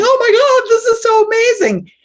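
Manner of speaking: high, loud gushing amazement; gushing Oprah. Retold or imitated. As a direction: imitate Oprah's over-the-top awe. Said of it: "Oprah's going".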